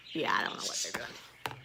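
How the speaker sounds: a secret whispered admission; whispered confession; whispered aside. Real, not acted. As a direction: whisper it under the breath. Aside, unvoiced.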